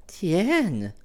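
high, light woman's voice, cool and teasing; young Parisian woman. Acted. Valentine asks Clifford why he is not at Versailles, in a high, teasing woman's voice. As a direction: play her as poised and a little mocking.

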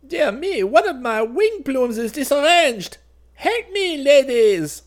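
very high, loud, fussy royal alarm; crying out. A narrator's reading. Vain king calling his ladies over a ruffled plume, crying out shrilly. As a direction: call for help as a piping, flustered monarch. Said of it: "paused and cried out".

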